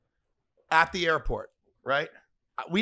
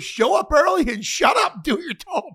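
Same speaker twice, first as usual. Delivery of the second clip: loud, high barked advice; gruff advice; 9 semitones higher, 5 dB louder than usual. Retold or imitated. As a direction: bark it like a tough mentor. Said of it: "He goes".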